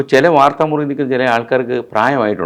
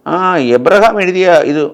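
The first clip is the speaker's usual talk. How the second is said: imitating a groping, higher-pitched on-air read; mock fumbling reading; 5 semitones higher, 7 dB louder than usual. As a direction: pretend to squint and stumble through small print, loudly. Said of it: "ഇങ്ങനെ തപ്പിത്തടഞ്ഞ്".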